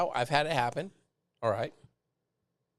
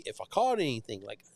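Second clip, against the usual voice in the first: ordinary talk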